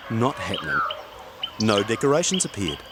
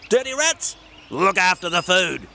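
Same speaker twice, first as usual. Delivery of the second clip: high, bossy little gnome; cheerful small gnome with a plan; Gordon the gnome; 7 semitones higher than usual. Acted. Gordon gives orders.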